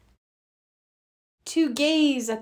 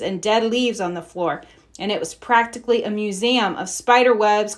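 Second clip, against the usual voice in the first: ordinary delivery, nothing marked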